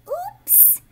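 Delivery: breathy, high little oops; whispered oops. Acted. Ralph's kick goes wrong.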